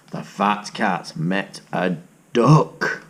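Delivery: slow, low read-aloud; low, slow storyteller; storybook narration. A narrator's reading. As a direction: savour each word for the children. Reading a picture book aloud.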